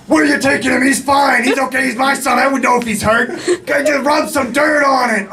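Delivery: high-pitched, indignant sideline shouting; mother still yelling. Acted. Mother protesting as her injured son leaves the field, high yelling. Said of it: "is still yelling".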